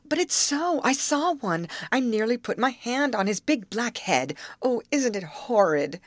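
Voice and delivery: high-pitched girlish alarm, acted. A narrator's reading. Frightened girls in a boat exclaim over an alligator, alarmed.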